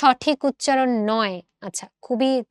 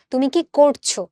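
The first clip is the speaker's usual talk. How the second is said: ordinary talk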